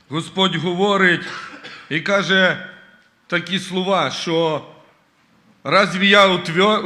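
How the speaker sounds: loud, impassioned sermon delivery; fervent preaching. Acted. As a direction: preach it out to the congregation. Preaching at full force. (пристрасна проповідь)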